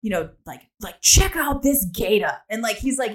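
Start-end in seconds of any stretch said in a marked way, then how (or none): 1.0-2.3 slow, loud Australian wildlife-presenter impression; Steve Irwin. Shown as a demonstration. Podcaster reluctantly demonstrating for co-hosts, excited Australian presenter impression. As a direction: show off the animal in a pumped-up Aussie voice. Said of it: "talk like Steve Irwin where he's like".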